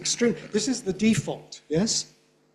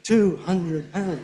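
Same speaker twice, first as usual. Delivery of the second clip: "hundred" unstressed, quicker; downgraded; slower than usual. Shown as a demonstration. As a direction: let hundred lose its beat. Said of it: "Downgrading is the norm".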